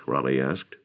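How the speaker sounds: ordinary delivery, nothing marked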